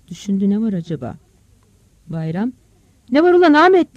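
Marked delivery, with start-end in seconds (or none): 3.1-4.0 loud, high shout to son. Acted. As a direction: call out sharply across a distance. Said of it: "diye bağırdı". (yüksek sesle bağırarak)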